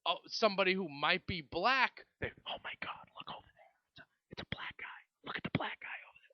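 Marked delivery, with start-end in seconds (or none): 2.5-6.3 hushed, breathy gossip whisper; mock whisper. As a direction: whisper it like nervous onlookers pointing. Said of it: "they start whispering".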